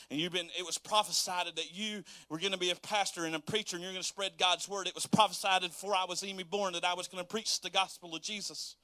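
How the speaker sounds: ordinary talk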